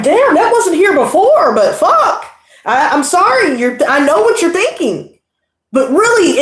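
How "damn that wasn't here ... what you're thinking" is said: laughing plea. Retold or imitated. Acting her sheepish reply.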